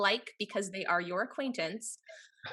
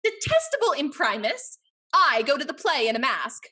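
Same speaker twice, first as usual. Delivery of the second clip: indignant outburst; 3 semitones higher, 7 dB louder than usual. Acted. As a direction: flare up in scandalised protest.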